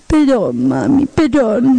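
slow, drawn-out mock-tearful apology; tearful little child. Toddler apologizing to mom over talc, tearful pleading. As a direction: imitate a toddler pleading through tears. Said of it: "empieza a caerle las lagrimitas".

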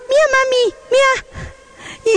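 put-on very high toddler voice; high, childish look-at-this call; excited little child. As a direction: imitate a tiny kid showing off to mom. Toddler showing drawings to mother.

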